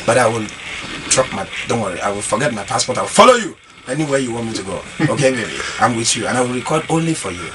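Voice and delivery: low, soft crooning voice; deep romantic serenade to Pepper; deep seductive voice. Pretend. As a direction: woo Pepper in a low, smooth voice. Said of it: "Let me check my voice again".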